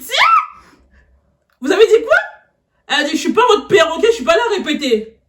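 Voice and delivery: high, loud indignant teacher; mock angry teacher. Teacher snaps back at the student, high and loud. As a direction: squawk it like an offended teacher.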